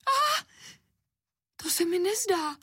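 very high-pitched squealed protest; girl's shriek. A narrator's reading. Anička sees the slippers on the table, shrieking high. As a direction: shriek it in a little girl's voice, without much extra volume. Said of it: "zaječela holčička".

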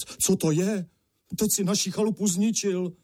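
high-pitched, aghast protest; alarmed cry. A narrator's reading. Father to son over the wrecked cottage, aghast exclamation. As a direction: exclaim in dismay, pitch jumping up. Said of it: "zvolal otec".

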